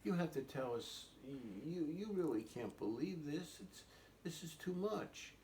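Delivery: lightly voiced older siblings reasoning; disbelieving siblings. Older siblings to little sister about made-up stories, mildly exasperated. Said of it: "And they said".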